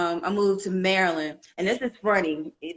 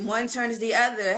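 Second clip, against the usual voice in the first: ordinary talk, nothing marked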